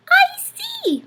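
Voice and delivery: small mouse squeaking, puzzled; squeaky mouse. A narrator's reading. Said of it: "Mortimer squeaked".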